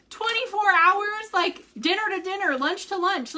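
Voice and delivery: high-pitched, marvelling retold reaction; acted amazement. Recalling news of the twenty-four fast, acted amazement.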